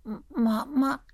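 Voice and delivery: faint, halting whisper of a word; weak. Acted. Sick daughter faintly recognises her mother. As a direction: barely get it out, dazed.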